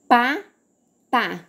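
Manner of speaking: "Pata" split as pa, ta; syllable by syllable. Shown as a demonstration. Teacher showing two syllables, "Pata" said in separated syllables. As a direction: leave a long gap inside "Pata". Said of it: "Bora ler mais rápido".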